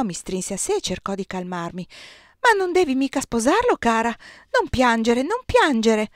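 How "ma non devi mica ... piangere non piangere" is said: louder, higher pleading to soothe; urgent comfort. A narrator's reading. Giulia hugs sobbing Désirée, louder urgent comfort. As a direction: rush to calm a crying friend.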